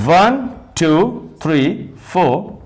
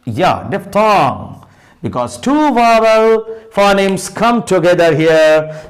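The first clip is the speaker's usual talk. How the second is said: ordinary talk, nothing marked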